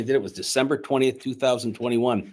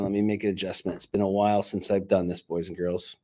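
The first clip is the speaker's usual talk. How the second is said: usual speaking voice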